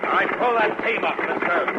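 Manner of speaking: loud, high call to halt the wagon; shouted command. Acted. Marshal shouting at the wagon driver to halt. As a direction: bellow the order across the distance.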